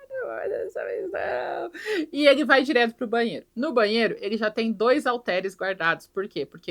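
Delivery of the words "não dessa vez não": mock self-restraint. Ed resisting the morning urge.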